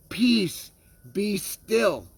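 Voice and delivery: lightly acted rebuke, each phrase set apart; rebuking. Jesus to the wind and sea, rebuking. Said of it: "rebuked the wind and said to the sea".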